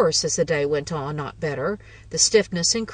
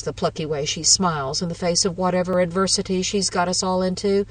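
usual speaking voice

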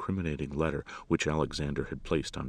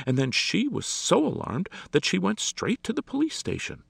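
high, loud, agitated lady's voice; excitable woman, pitched well up; flustered lady; 13 semitones higher, 5 dB louder than usual. A narrator's reading. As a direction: pour out the news in a fluster. Agitated lady explains the alarm.

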